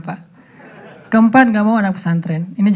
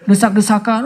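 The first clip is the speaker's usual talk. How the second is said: ordinary talk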